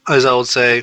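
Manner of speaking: plain reading voice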